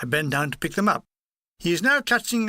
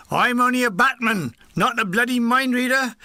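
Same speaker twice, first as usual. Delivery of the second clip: higher, indignant character voice; exasperated protest; 5 semitones higher, 4 dB louder than usual. Acted. Batman walking off from the gunners' questions, exasperated protest. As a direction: protest as you walk away.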